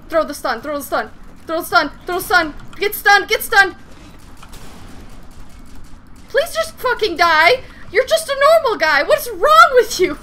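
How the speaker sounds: loud high desperate yelling; terrified horror victim. Real, not acted. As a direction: shout frantically in fear. Desperate fight with enemy.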